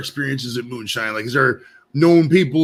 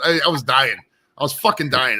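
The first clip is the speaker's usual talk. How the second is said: usual speaking voice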